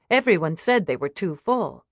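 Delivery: ordinary delivery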